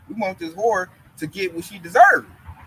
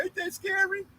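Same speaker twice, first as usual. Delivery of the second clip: higher, softer mock whine; mocking coworker; 11 semitones higher, 7 dB quieter than usual. Mocking the coworker's fearful question, higher and softer. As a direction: ask it like a timid coward. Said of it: "They even told me".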